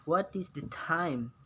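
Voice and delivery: slow, careful "What is the time?"; slow model sentence. Shown as a demonstration. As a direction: spell out each word slowly for learners. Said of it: "bisa Anda lihat". (ucapkan pelan dan jelas)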